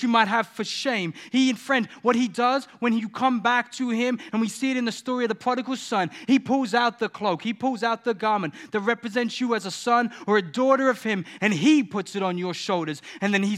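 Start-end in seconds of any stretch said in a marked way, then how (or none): none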